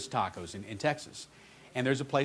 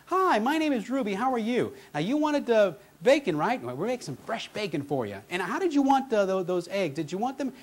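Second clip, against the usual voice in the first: high, bright-eyed drive-thru lady voice, much higher; Ruby's voice; 5 semitones higher than usual. Retold or imitated. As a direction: play the cheerful drive-thru server, pitched up. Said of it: "this lovely lady named Ruby says".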